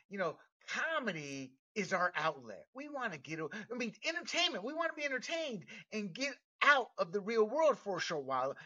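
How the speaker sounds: ordinary talk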